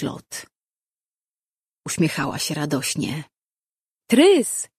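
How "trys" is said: high, loud joyful call. A narrator's reading. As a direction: call the name out to someone far off. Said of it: "zawołała".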